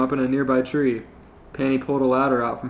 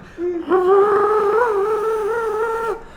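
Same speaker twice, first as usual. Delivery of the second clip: evil cackle. Acted. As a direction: cackle like a cartoon villain. Villain laugh.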